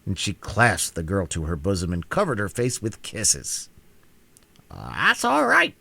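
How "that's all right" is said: slow, pleased hen voice; contented. Acted. Hen answers the Queen's joy, slow and content. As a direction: settle back satisfied. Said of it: "said Billina, contentedly".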